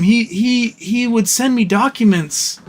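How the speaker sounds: ordinary delivery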